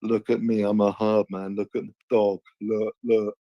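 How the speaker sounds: a hint of a swaggering thug; mock hard man voice. Mocks opponent as hard man.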